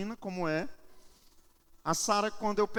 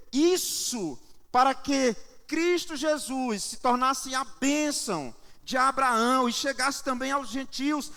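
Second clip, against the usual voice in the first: raised, forceful sermon delivery; preaching; 6 semitones higher, 5 dB louder, slower than usual. Real, not acted. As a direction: proclaim it to the congregation with heavy emphasis. Preaches to congregation. (pregação enfática, voz projetada)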